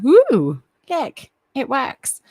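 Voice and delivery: loud, high whoop of relief; excited cheer. Real, not acted. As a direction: celebrate that the camera works. Camera starts working again.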